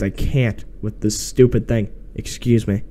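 ordinary delivery

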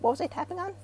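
high, light puzzled question; as if the trigger vanished; puzzled wonder. Retold or imitated. Telling the host how the trigger vanished, high puzzled wonder. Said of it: "and then like".

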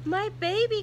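high, loud burst of pride; proud cry. A narrator's reading. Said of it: "cried Pig with pride".

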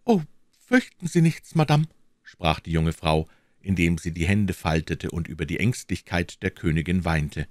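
high, devoted and tender; the maid pledges loyalty, gently; reassuring young woman. Acted. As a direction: comfort the queen, earnestly.

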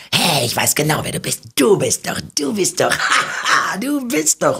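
high, excited stammering fan; mock excited fan, pitched high; eager fan. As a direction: play the fan who can't recall the name.

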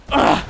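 sudden sharp shout; startled cry at a crash; loud startled cry. Real, not acted. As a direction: yelp as the car crashes. Crash in game.

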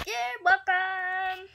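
slow, drawn-out make-believe voice; pretend trader voice. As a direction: stretch it out like a pretend shopkeeper. Kid voicing trading partner.

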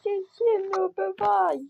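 loud, high-pitched begging; hammed-up pleading. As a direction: play a captive crying out for mercy. Plays captive begging for life. (награне голосне благання)

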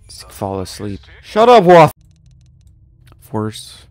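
ordinary delivery

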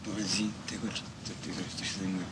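soft, low, hesitant address; too quiet to follow. Acted. Nervous poet opening his talk to an audience, too quietly. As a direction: mumble it softly and haltingly to the room. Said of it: "niech pan mówi głośniej".

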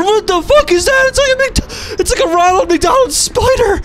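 very high, loud terrified screams; panicked shriek. Real, not acted. Player chased by Ronald spider, shrieking in panic.

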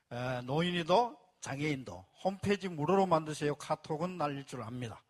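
usual speaking voice

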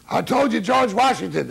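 high, loud mock scolding; exasperated retort. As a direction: act out a fed-up bandleader telling them off. Bandleader scolding musicians after train wreck.

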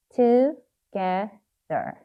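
"Together" slowly, one syllable at a time; sounding out while writing. Shown as a demonstration. Teacher telling learners to write, saying "Together" in syllables. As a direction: separate "Together" into to, ge, ther. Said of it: "발음을 하시면서 쓰셔야 됩니다".

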